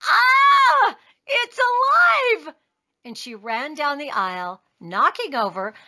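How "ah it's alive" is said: high, loud, drawn-out shriek; scream, then stretch the words in horror; lady's scream. A narrator's reading. Lady finds the doll is alive, screaming. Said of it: "The lady screamed".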